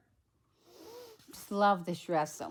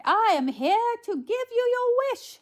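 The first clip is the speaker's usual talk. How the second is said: very high, loud proclamation; soaring fairy godmother voice; 6 dB louder than usual. A narrator's reading. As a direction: declare it grandly, high as a storybook fairy. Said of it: "I am your fairy godmother".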